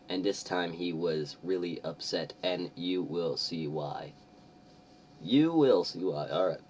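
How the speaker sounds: ordinary talk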